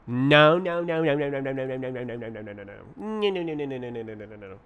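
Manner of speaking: rapid stutter of noes, starting loud, sliding down; rattled-off fading noes. Pretend. Coder refusing what is on screen, playful fading babble. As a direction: fire them off and let them sink away.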